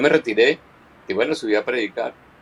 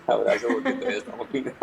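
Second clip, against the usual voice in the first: laughing; 4 semitones higher, 4 dB quieter, faster than usual. Real, not acted. Laughing refusal of hug.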